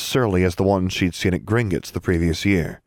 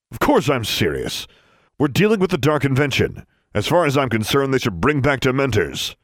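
fanatical rant; 6 semitones higher than usual. A narrator's reading. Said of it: "sounding less grandfatherly all the time".

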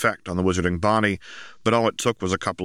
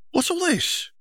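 put-on high bright girl voice, much higher; young girl voice. A narrator's reading. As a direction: voice Ginny as a teenage girl. Ginny's voice in story.

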